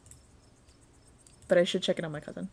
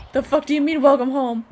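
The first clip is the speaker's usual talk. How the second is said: loud, higher, indignant retort; startled outburst; 5 semitones higher, 8 dB louder than usual. Acted. Stella challenges the creepy stranger's greeting, startled and loud. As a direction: throw the words back at them, loudly.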